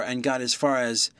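ordinary delivery

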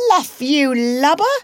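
high, loud pirate shout; Smee's cry. A narrator's reading. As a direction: call out sharply as a pirate sailor. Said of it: "cried an Irish voice that was Smee's".

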